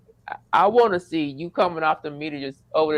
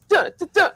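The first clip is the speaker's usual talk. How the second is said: imitated guitar plucks; 11 semitones higher, 4 dB louder than usual.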